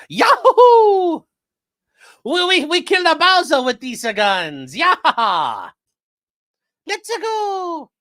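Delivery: high, loud cartoon plumber; squeaky excited Mario shouting. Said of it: "expecting him to go like".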